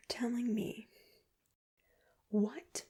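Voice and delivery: ordinary talk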